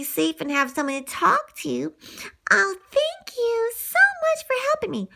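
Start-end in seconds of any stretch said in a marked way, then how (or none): 2.5-5.1 high thankful little dog; high grateful Lady voice; grateful puppy. Acted. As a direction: thank your rescuer sweetly.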